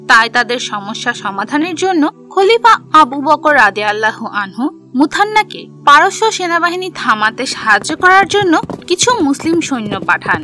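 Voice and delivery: ordinary delivery, nothing marked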